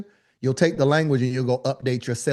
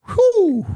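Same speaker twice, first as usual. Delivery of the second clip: high, loud whew of relief; 7 dB louder than usual. Real, not acted. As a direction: blow out a big high whew. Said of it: "relief after joke".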